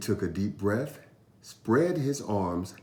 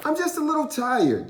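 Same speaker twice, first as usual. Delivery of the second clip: put-on high little-boy voice, making an excuse; boy voice covering his fear; fibbing boy; 4 dB louder, faster than usual. A narrator's reading. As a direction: fake tiredness as a small kid. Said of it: "Jabari said".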